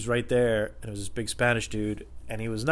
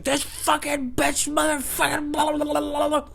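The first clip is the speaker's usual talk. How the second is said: high, drawn-out ranting dissolving into gibberish; mock ranting customer; slower than usual. Customer cutting off the employee, mock rant. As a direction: imitate an angry man babbling over someone.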